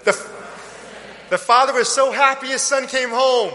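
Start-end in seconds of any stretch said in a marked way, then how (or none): 1.3-3.5 excited joyful father. Acted. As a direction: throw a party for the lost son. Father celebrates son's return.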